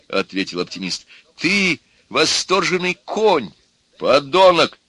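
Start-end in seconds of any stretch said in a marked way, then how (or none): 1.4-4.8 high, slow, emphatic name-calling; quarrelling insults. A narrator's reading. Pessimist abusing the optimist, heated quarrel. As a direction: build to a loud final insult.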